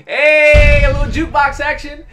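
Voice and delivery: high, loud playful shout; excited whoop. Real, not acted. Gamer whooping as his runner jukes a defender. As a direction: cheer the move. Celebrating a juke.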